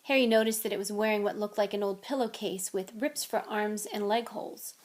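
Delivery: ordinary delivery, nothing marked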